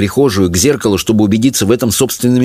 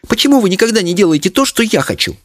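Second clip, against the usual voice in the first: put-on high, fast child's reproach; merciless retort; 12 semitones higher, faster than usual. A narrator's reading. Daughter blaming her parents, merciless. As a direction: fire it off high and pitiless. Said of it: "безжалостно отрезала Леночка".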